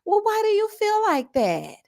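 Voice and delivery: high, slow, prissy imitation of the clerk; mocking the clerk. Imitating the profiling clerk's question in a high mocking voice. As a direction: put on a high fake-innocent voice. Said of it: "she comes over and she says".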